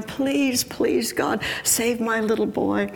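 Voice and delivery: high, acted pleading without sobs; crying plea. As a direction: implore as a desperate mother. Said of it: "she's crying".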